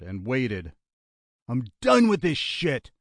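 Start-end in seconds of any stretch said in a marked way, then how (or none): 1.5-2.9 raised, angry outburst; fed-up shout. A narrator's reading. Gunman giving up on the plan, fed-up shout. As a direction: snap loudly that it's over. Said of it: "Lionel shouted".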